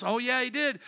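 normal talking voice

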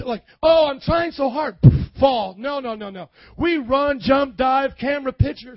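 loud, high, fired-up pulpit shout; preaching at full force. Real, not acted. Pastor mocking willful sinners to the congregation, shouting.

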